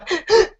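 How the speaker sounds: a sharp high gasp, imitating tearing up; little choked gasp of emotion; mock tearful gasp. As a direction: gasp as if moved by a commercial. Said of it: "and I go".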